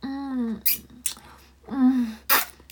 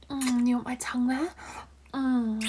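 ordinary delivery

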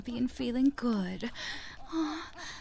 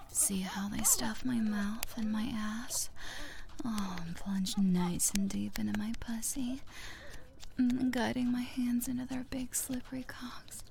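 soft intimate whisper; breathy ASMR whisper; 8 dB quieter than usual. Acted. Erotic roleplay whisper.

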